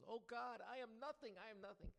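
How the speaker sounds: very high, loud theatrical wail; the highest, most dramatic cry of all. Retold or imitated. Third rabbi falling down before God, very high wail. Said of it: "he too falls down and he goes".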